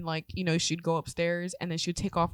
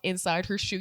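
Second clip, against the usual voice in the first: ordinary talk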